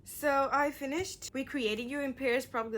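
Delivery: ordinary talk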